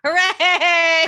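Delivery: high, loud cheer with laughter; jubilant cheer. Real, not acted. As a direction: whoop it out, half laughing. Players cheer a character surviving.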